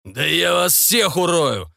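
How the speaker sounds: slow, high menacing howl; drawn-out furious threat; enraged yell. A narrator's reading. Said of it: "донёсся вопль Демьяна".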